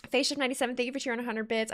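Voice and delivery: ordinary talk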